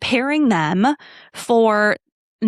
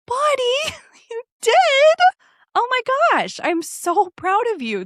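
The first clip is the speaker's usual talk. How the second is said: very high, thrilled and laughing; gushing proud mom. Retold or imitated. Mom praising her preschooler's bravery, high and excited. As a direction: squeal it like the proudest mom alive.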